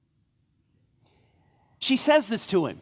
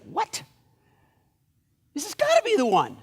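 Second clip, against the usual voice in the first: higher-pitched, acted disbelief; incredulous protest; 7 semitones higher, 3 dB louder than usual. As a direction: protest in mock astonishment. Samuel reacts to God's refusal.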